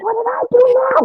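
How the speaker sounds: put-on high-pitched childlike complaint; acting out a wrongly accused pupil; retold whiny protest. Retold pupil told to stay behind.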